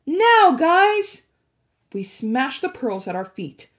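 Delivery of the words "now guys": high, loud urgent shout; Percy shouting to his friends to act now. A narrator's reading. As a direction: yell the signal to your friends. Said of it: "I shouted".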